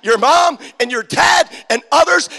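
loud, high, driving preacher's call; full-force preaching. Acted. Pastor rousing the youth, in a loud preaching shout. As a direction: preach it to the rafters.

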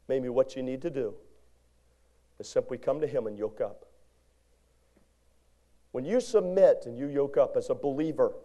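usual speaking voice

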